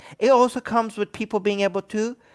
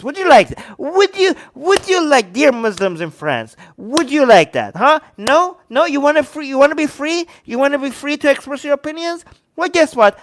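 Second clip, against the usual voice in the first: loud, high rant at the audience; real frustrated yelling; heated yelling; 8 semitones higher, 6 dB louder than usual. Real, not acted. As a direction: fire indignant questions, voice raised high. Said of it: "I need to yell at you guys".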